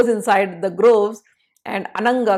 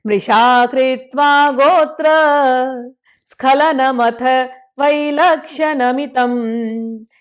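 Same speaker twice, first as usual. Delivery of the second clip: shloka recitation; 4 semitones higher, 5 dB louder than usual. Acted. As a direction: intone the verse devoutly. Chants Sanskrit shloka.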